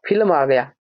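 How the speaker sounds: normal talking voice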